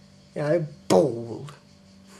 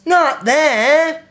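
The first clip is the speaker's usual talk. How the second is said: exaggerated, high, loud mimicry; high-pitched taunting copycat voice; mocking imitation; 10 semitones higher, 6 dB louder than usual. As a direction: parrot it back to make fun of them. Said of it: "they started doing impressions of us".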